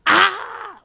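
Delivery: sharp high yelp of a hurt dog; sudden pained canine yelp. Retold or imitated. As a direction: yelp like a dog whose foot got stepped on. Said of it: "like that".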